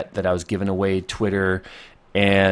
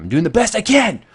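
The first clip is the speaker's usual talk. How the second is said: frustrated, much louder and higher; exasperated shout; 11 semitones higher, 7 dB louder than usual. Real, not acted. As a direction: snap back at complaining chat. Answering complaints, exasperated.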